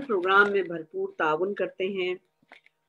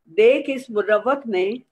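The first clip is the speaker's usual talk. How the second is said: slow, measured opening with weighted pauses; Urdu couplet recitation; 2 semitones higher, 4 dB louder, slower than usual. Acted. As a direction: recite a verse for a mushaira audience.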